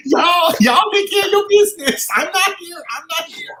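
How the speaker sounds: high pitched pretend child; hammed-up childish protest; mock kid. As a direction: squeal it like a kid hiding from a fight. Playing along, joking panic.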